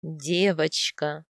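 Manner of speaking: low, slow husband's confirmation; husband's answer. A narrator's reading. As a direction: confirm it slowly in a man's voice. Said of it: "ответил муж".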